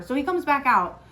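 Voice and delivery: ordinary talk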